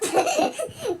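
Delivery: sudden high laughing burst; burst of laughter. Real, not acted. Reader cracking up at the monkeys.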